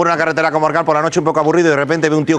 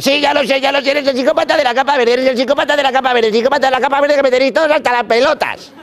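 higher, fed-up ranting; exasperated driver; 9 semitones higher than usual. Acted. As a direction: rattle it off, totally sick of it. Fed-up driver pre-empts third robber.

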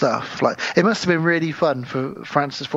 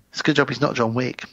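ordinary talk, nothing marked

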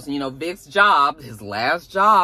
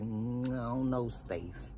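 1 doubtful murmur; 4 semitones lower, 13 dB quieter, slower than usual. Retold or imitated. Hubby doubts Stacy's plan.

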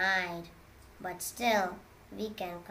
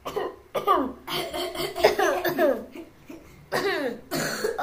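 loud, high pretend coughs; hammed-up coughing; fake coughing fit; 10 semitones higher, 7 dB louder than usual. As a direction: hack away in a put-on coughing fit. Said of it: "So much faking is there".

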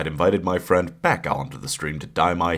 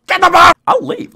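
very high, loud yelled order; high furious yell at a stubborn pet; shouted command; 12 dB louder than usual. Real, not acted. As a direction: bellow it at the dog. Ordering dog into box.

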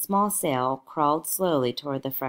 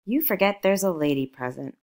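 usual speaking voice

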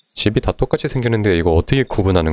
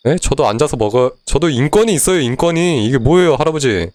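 indignant protest; 6 semitones higher than usual. Real, not acted. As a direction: demand to be treated fairly. Demanding his rights to grandpa. (억울해서 할아버지에게 따지기)

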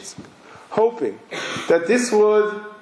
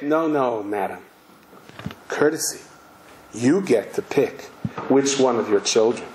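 lightly acted, measured politeness over menace; mock courtesy; 8 semitones lower than usual. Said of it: "with the most sweetest smile".

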